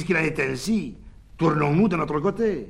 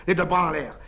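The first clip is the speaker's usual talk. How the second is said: plain reading voice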